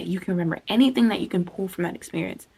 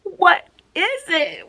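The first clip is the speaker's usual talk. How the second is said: exasperated plea; 11 semitones higher, 5 dB louder, much slower than usual. Retold or imitated. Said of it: "and I was like, Ugh".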